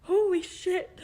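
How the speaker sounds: high, loud tearful outburst; words pushed out through real tears; crying. Real, not acted. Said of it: "look, I'm crying".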